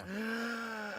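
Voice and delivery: big audible breath in; quick theatrical inhale; sharp gasp. Acted. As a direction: gulp air before racing on. Big breath before next recap part.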